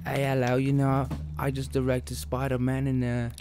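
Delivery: a mild English director impression; British accent. Friend mimics director in a light British accent. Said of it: "I don't wanna do the accent, but I'll do it right now".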